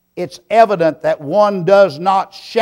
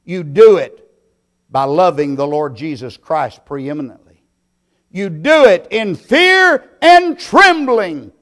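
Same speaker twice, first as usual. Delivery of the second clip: soaring, high and loud pulpit cry; preaching at full force; 3 semitones higher, 8 dB louder than usual. Acted. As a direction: lift the congregation with a ringing call.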